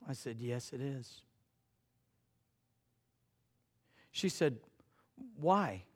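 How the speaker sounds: soft, intimate storytelling voice; hushed tender retelling. Retold or imitated. Pastor softly recounting a crying girl at camp, hushed. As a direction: draw the congregation in close and gentle.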